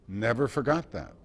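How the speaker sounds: ordinary talk, nothing marked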